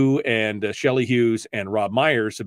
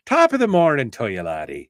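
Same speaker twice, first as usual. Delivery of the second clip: full-on Irish accent; 2 semitones higher than usual. Shown as a demonstration. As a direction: go all in on a stage Irish accent. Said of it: "You gotta do the accent and everything".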